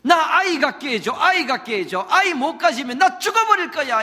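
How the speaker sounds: desperate wife hurling demands; demanding cry. Acted. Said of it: "이렇게 막 덤비니까".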